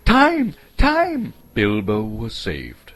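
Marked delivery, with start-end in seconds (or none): 0.0-1.3 very high, loud squeal; sudden squeal. A narrator's reading. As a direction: squeal it out in panic. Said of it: "a sudden squeal".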